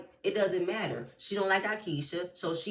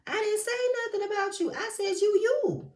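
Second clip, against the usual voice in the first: squeaky high mock of Glenn; 3 dB louder than usual. Mocking Glenn's excuse in a squeaky high voice. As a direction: pitch way up to mock him.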